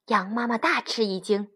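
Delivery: plain reading voice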